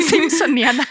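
word laughed out; laughing through the word. Real, not acted.

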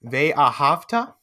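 "Ve'ahavta" said slowly, syllable by syllable; teacher's slow, separated model word; staccato. Shown as a demonstration. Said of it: "We'll do one word at a time".

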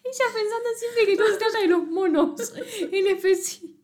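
high, laughing teasing voice; giggling jab at her friend. Real, not acted. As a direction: tease her through laughter. Laughing at friend's monkey obsession.

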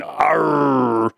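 long drawn-out pirate "arr"; pirate impression, one long sound; pirate growl. Shown as a demonstration. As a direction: roll out a mock pirate arr. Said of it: "a pirate, I mean, other than saying".

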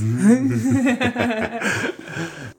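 bursting out laughing high; a sudden high laugh. Real, not acted. Laughs at the reply "jamais.

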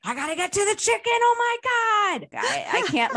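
high, loud, stretched-out panicked squeal; hammed-up frantic cook; frantic mock panic. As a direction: play your own kitchen meltdown, way up high. Imitating frantic self while cooking.

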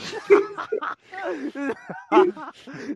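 high, loud burst of laughter. Real, not acted. Friend hearing the bathroom joke, loud high laugh. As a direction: crack up loudly.

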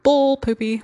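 high, loud scoffing dismissal; mocking inner critic. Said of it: "this part of me would be like".

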